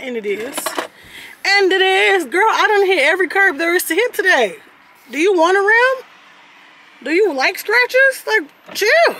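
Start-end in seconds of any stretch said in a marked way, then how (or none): 1.5-9.2 loud exasperated rant. Real, not acted. Ranting at car about curbs.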